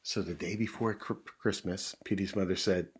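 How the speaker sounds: ordinary delivery, nothing marked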